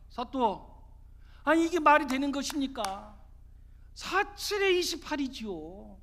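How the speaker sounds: high, loud indignant questioning; protesting challenge. Acted. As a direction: demand an explanation from the magistrate. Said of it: "사또에게 따져 묻습니다". (억울해서 따져 묻기)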